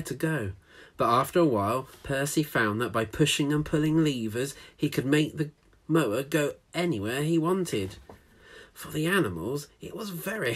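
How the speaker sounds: lively read-aloud storytelling voice; story narration. A narrator's reading. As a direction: read it like a picture book to children.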